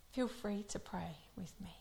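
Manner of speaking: soft unvoiced whisper of feel free to pray with me; hushed prayer invitation. Real, not acted. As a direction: breathe the invitation.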